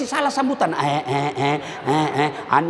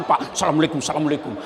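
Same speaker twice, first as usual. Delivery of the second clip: mock humble greeting; 11 semitones lower, much faster than usual. Candidate greeting voters humbly.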